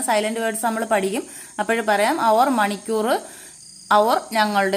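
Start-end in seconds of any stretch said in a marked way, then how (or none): none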